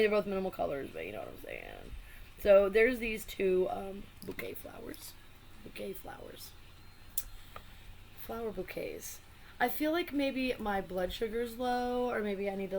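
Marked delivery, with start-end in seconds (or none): 3.7-9.2 under her breath, unvoiced; whispered. Real, not acted. Vlogger whispering to herself while naming the cards. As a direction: whisper it to yourself. Muttering to herself mid-review.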